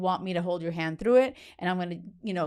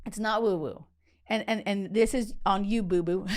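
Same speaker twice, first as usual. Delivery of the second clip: ordinary talk, nothing marked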